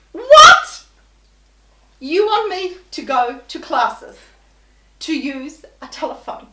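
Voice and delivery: outraged customer yelling at a call-centre agent; furious outrage. Retold or imitated. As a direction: blast it back in disbelief. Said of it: "I was ropeable".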